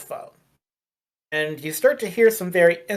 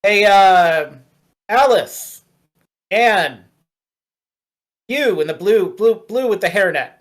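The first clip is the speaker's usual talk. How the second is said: louder manager's yell to staff; calling out; 3 semitones higher, 6 dB louder, slower than usual. Acted. Manager yelling at a worker from the door frame, calling out. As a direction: call to an employee whose name escapes you. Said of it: "and yells at".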